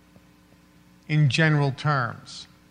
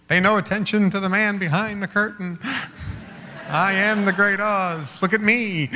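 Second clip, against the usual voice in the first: higher, slowed booming wizard voice; 4 semitones higher than usual. Pretend. Wizard of Oz commanding the audience, hammed-up and higher. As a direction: play the wizard hiding behind the curtain.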